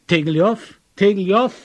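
loud, high repeated calls; calling out. A narrator's reading. Narrator stumbling in fog keeps calling, loud. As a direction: shout his name, rising. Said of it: "rief ich".

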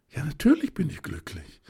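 plain reading voice

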